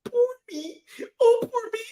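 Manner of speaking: high mocking self-pity whine; whiny poor-me act. Roaster mimics Wesley playing the victim, whiny mockery. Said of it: "And then you play the".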